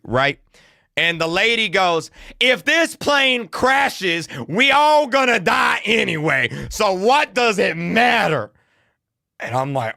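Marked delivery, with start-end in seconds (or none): 2.4-8.5 imitating a fed-up mom, high and drawn out; indignant retort. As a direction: argue it back slowly, pitched high. Mom argues with attendant.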